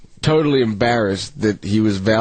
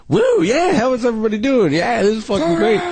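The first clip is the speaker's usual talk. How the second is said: high, wild, amped-up cheer; hyped-up party voice; 13 semitones higher than usual. Pretend. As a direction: act like a wired partier. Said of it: "I'd rather see him, like".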